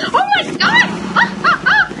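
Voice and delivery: high, loud shrieking; excited shrieks. Real, not acted. Reacting wildly to the game.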